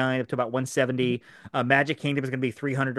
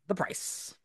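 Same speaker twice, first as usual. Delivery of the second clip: near-whisper, much softer; hushed reveal; 4 semitones higher, 10 dB quieter than usual. Real, not acted. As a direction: drop to a whisper for the dreaded detail. Dramatic reveal of sticky point.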